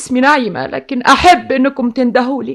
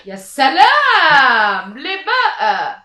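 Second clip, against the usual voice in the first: drawn-out sarcasm; much slower than usual. Acted.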